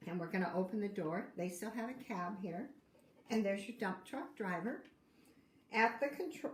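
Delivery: usual speaking voice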